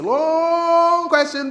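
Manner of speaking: "long" hugely stretched on a high, loud note; drawn-out LONG. Shown as a demonstration. Emcee mocking audience members' endless questions, "long" stretched out. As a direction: hold "long" high and loud, exaggerating its length.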